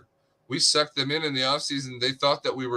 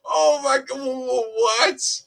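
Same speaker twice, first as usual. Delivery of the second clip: shocked; 12 semitones higher, slower than usual. Acted. Player voiced reacting to the trade offer.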